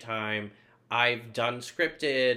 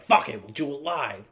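punchy, louder "Fuck it, we'll do it live"; heavy, emphatic delivery; intense attitude; 3 semitones higher, 6 dB louder than usual. Shown as a demonstration. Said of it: "extreme, intense type of attitude".

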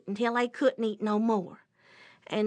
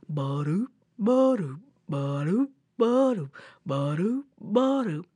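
sauntering bear footfalls; 3 semitones lower than usual. Acted. Imitates bear sauntering along.

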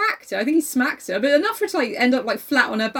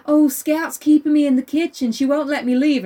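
high, loud whiny tattle to a grown-up; mock a kid telling on someone; loud, higher-pitched mock complaint; tattling kid; 6 semitones higher, 6 dB louder than usual. Acting a whining tattling cousin.

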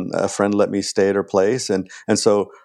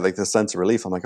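usual speaking voice

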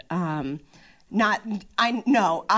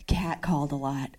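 whispered; 5 semitones lower, 4 dB quieter than usual. Real, not acted. Aside about catcalling.

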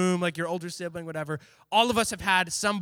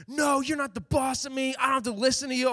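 put-on higher, childish defiant kid; defiant kid brother; 7 semitones higher than usual. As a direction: talk back like a stubborn little brother. Little brother defying older sibling.

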